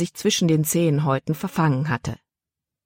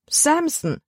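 put-on Samson called out much higher, in a girl's voice; girl calling out; 12 semitones higher than usual. A narrator's reading. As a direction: cry the name Samson in dismay, high up. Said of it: "rief Libby".

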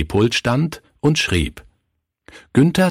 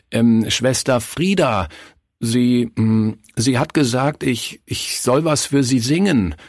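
put-on higher, halting, hesitant boy; stammering; 6 semitones higher than usual. A narrator's reading. Shy Günther explains himself to the pastor, stammering. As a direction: stammer with ums and restarts. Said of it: "stammelte er".

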